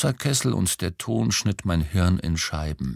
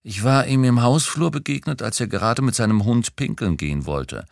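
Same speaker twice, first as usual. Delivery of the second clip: usual speaking voice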